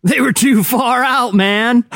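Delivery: hippie voice. Acted. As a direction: belt out the punchline like a surfer hippie. Hippie joke punchline.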